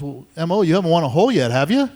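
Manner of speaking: mock-innocent jab with a tag question; retold taunt. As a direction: needle an opponent for the crowd to hear. Retold taunt before laughing gallery.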